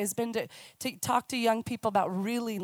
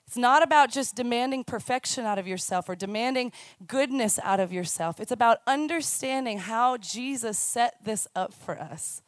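ordinary talk, nothing marked

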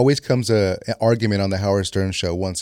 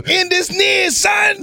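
much higher, shouted hype-man rasp; [name] voice; slower than usual. Retold or imitated. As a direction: yell it like a frantic doom prophet. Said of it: "Nick V would do a [name] impersonation".

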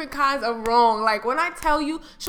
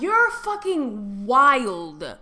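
drawn-out disbelief; 3 semitones lower, 5 dB louder, much slower than usual. Retold or imitated. Retelling her reply to dentist.

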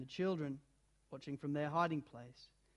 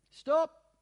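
sharp loud command; high loud stop order; 9 semitones higher, 9 dB louder than usual. A narrator's reading. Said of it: "said the witch".

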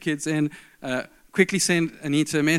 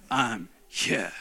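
curt, cold two words; 5 semitones lower, 5 dB quieter than usual. Retold or imitated. Wife texting her late husband, coldly displeased. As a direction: lower and softer, clipped and frosty. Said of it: "I could hear the tone".

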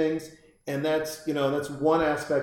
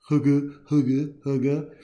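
dog retching imitation. Imitating his dog throwing up.

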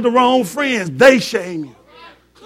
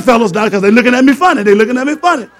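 ordinary delivery

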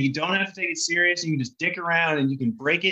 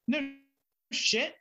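taken aback; 10 semitones higher, 3 dB quieter than usual. Retold or imitated. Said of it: "like, taken aback".

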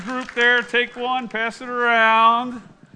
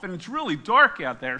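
ordinary talk

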